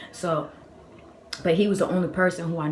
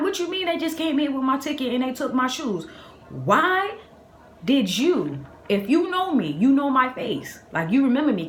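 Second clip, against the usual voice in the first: high, louder indignant retelling; outraged disbelief; 8 semitones higher, 4 dB louder than usual. Retold or imitated. Customer hears someone took her shoes, outraged disbelief. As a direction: confront them, pitch jumping up on key words.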